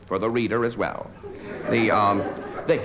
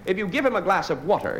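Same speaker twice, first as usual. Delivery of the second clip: ordinary delivery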